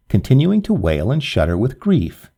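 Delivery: ordinary delivery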